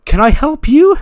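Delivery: high, loud, indignant; hostile mock surprise. Charlotte confronting the woman eating cake, high indignant snap. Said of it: "And she's like".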